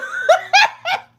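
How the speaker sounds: high helpless laugh; can't stop laughing at the memory; burst of laughter. Real, not acted. Telling viewers about framing her sister, high burst of laughter.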